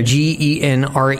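ordinary talk

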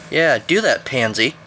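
usual speaking voice

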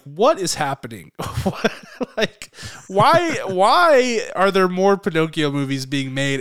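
exasperated replay of a rant, pitch jumping on WHY; baffled, protesting "why"; retold rant. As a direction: relive your outburst in mock exasperation. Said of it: "I went on a rant".

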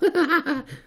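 silly high-pitched cackle at his own pun; squeaky pleased laugh; high goofy laugh. Acted. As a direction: laugh like a goofball proud of his joke. Silverbug laughs at own joke.

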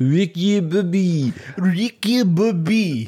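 slow Southern drawl on Ricky Bobby; nascar drawl. Pretend. Co-host drawling the movie hero's name slowly. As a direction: stretch it out like a race fan.

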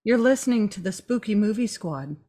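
mock therapist. Host playing therapist to Micah, lightly soft-spoken.

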